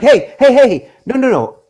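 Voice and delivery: retold alarmed yell; frantic shout. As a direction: yell at the front desk in a panic. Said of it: "I'm like".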